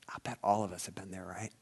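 quick, soft whisper; fast hushed whisper; confiding whisper. Acted. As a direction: share it like a confession. Confiding aside to the congregation.

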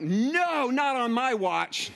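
high, loud, slow declaration; dad swearing to save the bunny, resolute; determined. Retold or imitated. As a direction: vow it like a hero charging in. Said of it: "And I'm like".

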